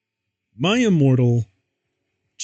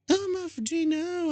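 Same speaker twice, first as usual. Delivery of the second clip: screamed; 7 dB quieter than usual. A narrator's reading. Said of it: "I screamed as I fell down".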